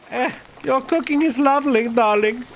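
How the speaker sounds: put-on husband in a German accent. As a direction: play Klaus, German-accented, to his wife. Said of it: "I was doing a fucking German voice for".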